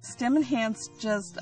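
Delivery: ordinary talk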